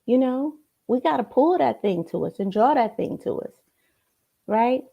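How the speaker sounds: ordinary delivery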